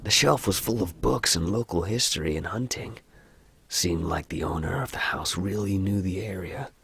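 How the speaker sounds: soft American story narration; understated American narrator; American accent. Acted. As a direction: read it softly in an American accent. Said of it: "I don't like the American accent".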